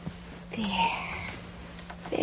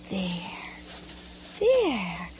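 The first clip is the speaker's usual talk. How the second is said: soft soothing; 6 semitones lower, 11 dB quieter than usual. Acted. Mother soothing child softly.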